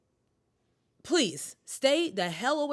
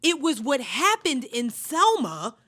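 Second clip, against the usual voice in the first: higher and louder, slowing on Lyndon Johnson; animated storytelling; 6 semitones higher, 4 dB louder than usual. Real, not acted. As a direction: build up the reveal with emphasis. Mocking retelling of Lyndon Johnson.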